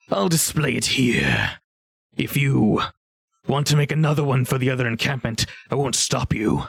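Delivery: measured ogre voice, grudgingly approving; unhurried ogre character voice; ogre trader. Acted. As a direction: play an ogre granting permission. Game master plays ogre trader.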